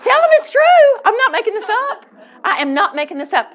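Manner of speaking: high, loud, laughing plea; laughing, begging to be believed; laughing insistence. Real, not acted.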